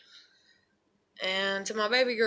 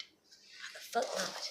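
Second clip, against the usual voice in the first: whisper; 2 semitones lower, 9 dB quieter, much slower than usual. Real, not acted. Repeats line, second whispered.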